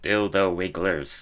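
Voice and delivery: slow Microsoft Sam robot voice; slow computer voice. Shown as a demonstration. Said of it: "do your robot voice and say".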